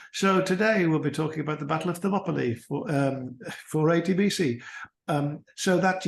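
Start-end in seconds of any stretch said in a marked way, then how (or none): 0.1-4.6 blocked-nose broadcaster impression; higher, nasally blocked presenter voice; Melvin Bragg. Shown as a demonstration. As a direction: imitate a radio host introducing a topic, nose blocked. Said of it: "the way that Melvin Bragg".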